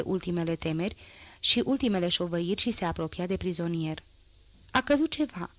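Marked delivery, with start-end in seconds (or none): 4.7-5.5 mild narrated cry of alarm, pitched higher; a light read-aloud shout, not full force. A narrator's reading. Wife spots something falling, mild shout. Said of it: "strigă Thénardiera".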